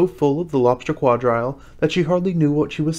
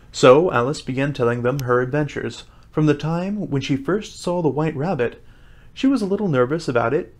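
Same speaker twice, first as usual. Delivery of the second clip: plain reading voice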